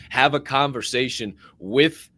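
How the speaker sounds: ordinary talk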